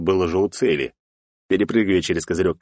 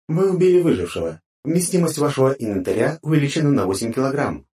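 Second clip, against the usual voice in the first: system announcement; 7 semitones higher than usual. A narrator's reading. Game system notification.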